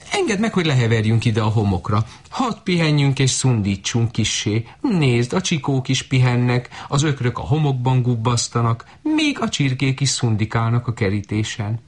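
high quick goose voice; goose asking. Acted. Goose coaxing the shepherd girl to let them nap, high and quick.

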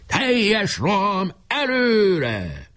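high, very slow drawn-out order; battle command. A narrator's reading. Leader raising the flag for the final charge, commanding. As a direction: stretch each word into a rallying cry. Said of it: "kiadta az utolsó parancsot".

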